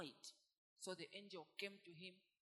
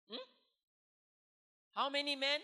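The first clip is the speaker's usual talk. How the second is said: much louder, higher call to the congregation; preacher's call; 6 semitones higher, 16 dB louder than usual. Real, not acted. Preacher quizzing the congregation, loud call. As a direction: demand the answer from the room. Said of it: "God told him to gather how many men".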